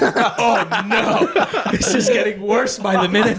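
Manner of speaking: high-pitched, helpless laughing panic; laughing mock dismay. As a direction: squeal it through laughter as doom closes in. Retold panic, cracking up laughing.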